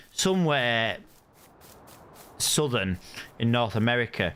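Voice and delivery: usual speaking voice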